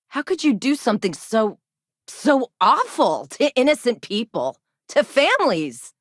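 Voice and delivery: halting, then rising moral outrage; appalled outrage. Acted. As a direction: demand an answer, horrified for the victims. Confronts wrongdoing against families.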